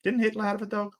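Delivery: sly rhetorical jab. Real, not acted.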